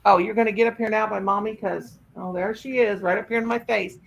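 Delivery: ordinary talk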